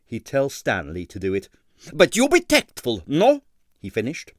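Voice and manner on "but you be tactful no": high, loud coaxing impresario; Stroganoff's accented plea. A narrator's reading. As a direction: wheedle a favour from Stanley. Stroganoff voiced in story.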